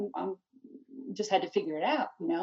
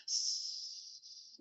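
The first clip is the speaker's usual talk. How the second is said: long soft sustained hiss; imitated hissing noise; 11 dB quieter than usual. Mother imitating the noise her son made, soft hiss. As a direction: hiss like leaking air. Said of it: "I hear this hissing noise".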